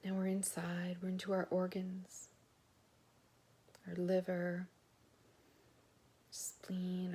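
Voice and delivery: soft, slow meditation voice, lingering on each part; guided body scan. Real, not acted. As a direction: lead listeners quietly through the body, pausing between phrases.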